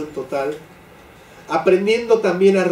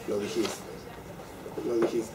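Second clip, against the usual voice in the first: quiet reproach; 6 semitones lower, 14 dB quieter than usual. Acted. Reprocha al que se arrepiente.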